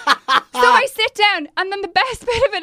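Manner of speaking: words squeezed out through laughter; real laugh while telling. Real, not acted. Laughing through her story.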